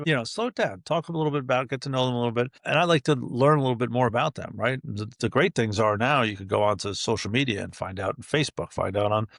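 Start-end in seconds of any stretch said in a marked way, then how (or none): none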